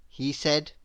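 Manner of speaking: usual speaking voice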